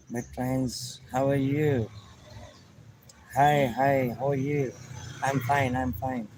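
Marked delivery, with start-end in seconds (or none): none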